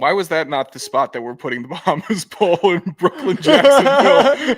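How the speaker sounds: helpless laugh building to a shout; cracking up. Real, not acted. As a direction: break into loud laughter on the last word. Cracking up at the Brooklyn joke.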